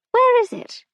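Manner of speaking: put-on high, fluttery old lady voice; a dotty aunt who can't find the butter; squeaky befuddled auntie; confused elderly aunt. A narrator's reading.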